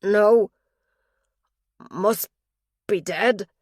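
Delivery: loud, anguished confession; distressed. A narrator's reading. Dying father to his daughter, distressed and loud. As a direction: blurt out the guilt in distress.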